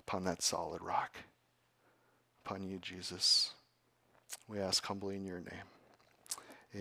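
soft, low, hushed praying; earnest prayer. Real, not acted. Pastor praying over the congregation, soft and humble.

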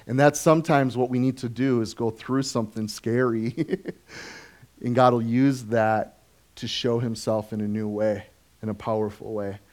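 ordinary talk

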